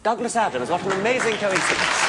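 loud, high pretend surprise; mock amazement. Host greeting the planted volunteer, mock amazement.

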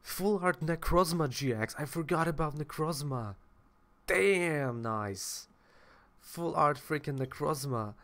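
light up over a rare find; excited. Real, not acted.

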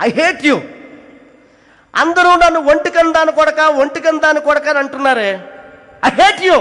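high, loud angry yell; furious outburst. Retold or imitated. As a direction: scream your hatred at her. Son screaming at his mother.

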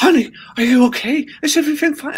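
high-pitched, sing-song cooing girlfriend imitation; mock fussing ex. Doting ex checking on a bumped finger, mock fussing. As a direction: coo with exaggerated high-voiced concern.

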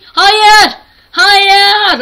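loud, high drawn-out no; despairing cry. Real, not acted. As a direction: wail it as it all goes wrong. Sona gelip düşüyor. (yüksek sesli umutsuz çığlık)

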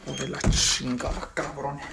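very low, hushed cursing; muttered curse. Acted. Swearing to oneself after the call, muttered. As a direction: grumble it deep under your breath.

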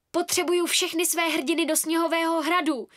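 high, loud rallying order; urgent leader. A narrator's reading. Girl rallies her friends to the snow castle, urgently. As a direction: summon the troops insistently. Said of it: "naléhala jsem z pozice vůdkyně".

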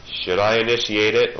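ordinary delivery

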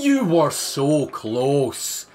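drawn-out, dismayed wail; anguished near-miss. Real, not acted. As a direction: stretch the words out in disappointment. Dismay as the dog misses.

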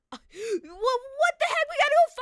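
drawn-out, very slow, high disbelief; stunned cry. Real, not acted. Officer staring at the wrecked car in drawn-out disbelief. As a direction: stretch out the shock. Sees all four tires spiked.

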